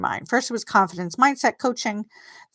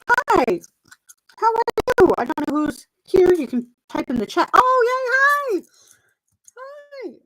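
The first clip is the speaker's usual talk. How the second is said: excited greeting; 5 dB louder than usual. Real, not acted. Greeting viewers joining live.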